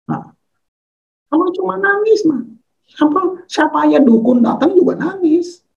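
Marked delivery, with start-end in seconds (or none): 1.3-5.6 high-pitched mock dismissal; much higher, unimpressed retort; dismissive scoff. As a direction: scoff at it in a raised, incredulous pitch. Scoffing at friends' report of crying.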